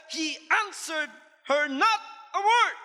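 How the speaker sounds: slow solemn scripture reading. Acted. Preacher reading scripture to congregation, slow and solemn. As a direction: read the verse slowly and weightily.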